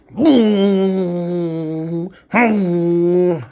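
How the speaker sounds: loud imitated crash of speed, high; mock engine boom. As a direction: boom it out like a racing car. Daughter speeding, car noise.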